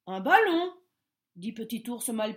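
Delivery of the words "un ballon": higher, louder surprised question. A narrator's reading. Bear surprised at the rabbits' question, higher and louder. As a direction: caught off guard.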